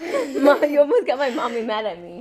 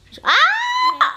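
high squeal of pain and laughter; squealing laugh cry. Real, not acted. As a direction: shriek while being scratched. Being scratched, cries out.

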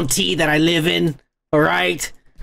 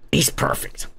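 much lower, softer, breathy rumbling imitation; deep actor voice; 5 dB quieter than usual. Praising the casting in the actor's much lower voice. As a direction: growl it low like the giant villain. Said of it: "He's got a fucking deep ass voice".

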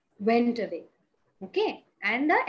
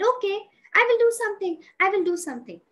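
eager promise; 6 semitones higher, 6 dB louder, faster than usual. Acted. Eager firefly promises to help.

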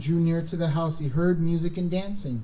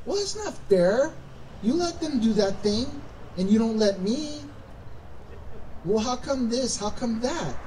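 higher-pitched protest of unfairness; mock complaining; 4 semitones higher, 3 dB quieter than usual. Kids protesting to parents over unequal treatment, aggrieved. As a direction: complain like a sibling who feels short-changed. Voicing those who cry unfair.

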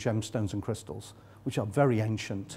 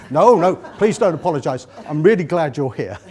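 laughing; 3 semitones higher, 11 dB louder than usual. Real, not acted. Audience member apologises, he laughs.

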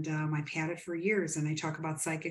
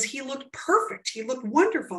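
mother's delighted gushing, higher pitched; lifted, emphatic retelling of a happy report; retold delight; 6 semitones higher, 4 dB louder than usual. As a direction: gush about how wonderful he looked. Mother retold describing her dream visit.

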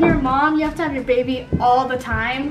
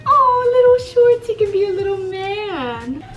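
gushing; 7 semitones higher, 4 dB louder than usual. Real, not acted. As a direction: squeal with delight, then ease off. Gushing over baby clothes.

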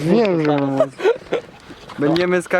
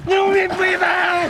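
panicked shout; 12 semitones higher, slower than usual. Real, not acted. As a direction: yell it in real panic. Panicking in cold water.

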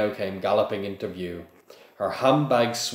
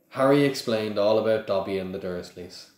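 plain reading voice

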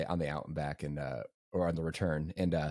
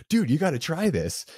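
much higher, louder eager pitch; eager offer; 10 semitones higher, 5 dB louder than usual. Retold or imitated. Aid station friend pushing French toast on nauseous runner, eager and high. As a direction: push the food on him, all enthusiasm.

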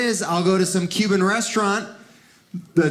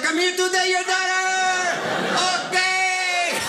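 much higher, hammed-up party dude voice; mock maraca dude; 12 semitones higher than usual. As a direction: announce yourself like a cartoon showman. Imagined maraca dude at door.

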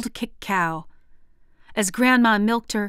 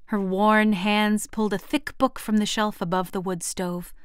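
usual speaking voice

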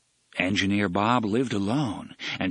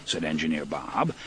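usual speaking voice

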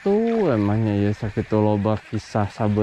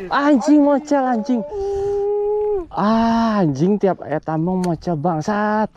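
angry cursing; 10 semitones higher than usual. Real, not acted. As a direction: curse the lost fish. Fish escapes again, cursing.

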